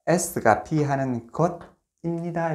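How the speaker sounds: S가 B하는 것입니다 spelled out very slowly; slow dictation. Shown as a demonstration. Teacher dictating a pattern to students, very slowly. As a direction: dictate each word deliberately. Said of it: "dictating a formula".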